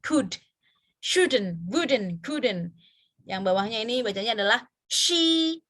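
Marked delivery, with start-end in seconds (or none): 1.0-2.8 Shouldn't, wouldn't, couldn't read out in a row; drill words. Shown as a demonstration. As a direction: recite the word list clearly.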